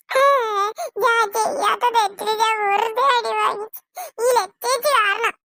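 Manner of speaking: high, sobbing complaint, slowing at the end; crying child. Acted. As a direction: cry over the unfair beating. Girl cries after being hit unfairly.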